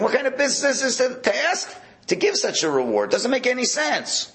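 high-pitched, exasperated protest; incredulous objection. Acted. Teacher voices David objecting to the reward, incredulous. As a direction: object as if it were absurd.

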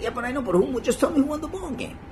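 high, pestering mock voice; mock pest begging for the score; pushy high chatter. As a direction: nag like a chatty congregant. Pushy talker mimicked during reading.

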